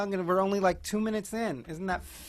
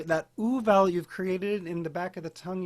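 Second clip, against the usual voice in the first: normal talking voice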